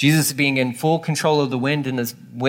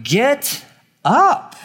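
much higher, louder yell; rude yell; 10 semitones higher, 4 dB louder than usual. Retold or imitated. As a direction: bark it like a panicked disciple. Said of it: "the emotion behind the text is that of kind of being rude".

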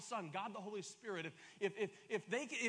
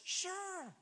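eager yes; 12 semitones higher than usual. Retold or imitated. Eager kid accepting the deal.

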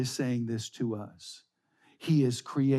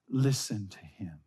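whispered command; 7 semitones lower, 5 dB quieter than usual. Acted. Preacher quoting God's voice from the cloud, whispered. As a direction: drop to an unvoiced whisper, low.

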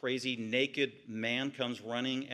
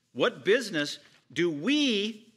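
slow, drawn-out demon shriek, louder and higher; 5 semitones higher, 6 dB louder, much slower than usual. Acted. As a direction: wail the question slowly like a possessed man. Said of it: "he said in a loud, shrieking voice".

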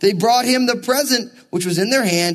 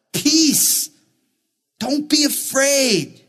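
preached urging; 5 semitones higher, much slower than usual. Real, not acted.

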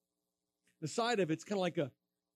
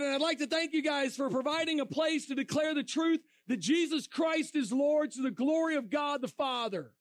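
preaching proclamation; 8 semitones higher than usual. Retold or imitated. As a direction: proclaim it to the crowd. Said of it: "I said".

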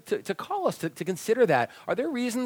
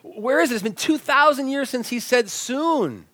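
high, fast scoffing disbelief; skeptic's raised, disbelieving challenge; incredulous; 8 semitones higher than usual. Retold or imitated. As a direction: raise your voice in mocking doubt. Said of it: "There's a sense when people say".

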